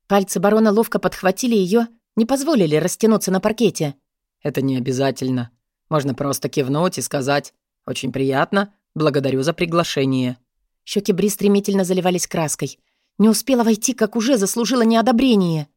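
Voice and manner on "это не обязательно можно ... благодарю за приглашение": low baron voice. Acted. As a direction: speak as the smooth dark baron.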